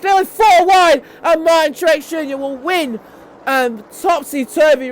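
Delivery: high, loud shouted play-by-play; thrilling finish call. Real, not acted. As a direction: build to a peak at the line. Calls the final lap.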